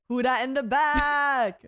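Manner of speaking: slow, loud, drawn-out call to the back of the room; hollered playfully across the club, dragging each word; mock call-out.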